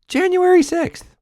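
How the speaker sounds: very high-pitched exclamation; shrieky falsetto exasperation; exasperated squeal. Acted. Exasperated outburst, then repeated normally.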